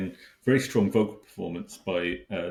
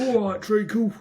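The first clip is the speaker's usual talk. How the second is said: cockney geezer; 11 semitones higher, 5 dB louder than usual. Pretend. Mock Cockney greeting as character.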